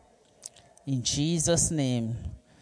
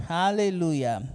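usual speaking voice

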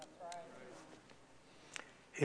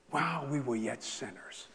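ordinary talk

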